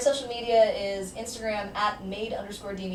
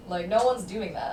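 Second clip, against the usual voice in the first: ordinary talk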